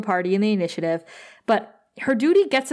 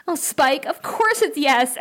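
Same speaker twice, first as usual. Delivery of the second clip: higher, gushing retold yes; mock delighted acceptance; 6 semitones higher than usual. Buffy accepting Spike's proposal, mock delighted. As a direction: imitate a thrilled fiancée.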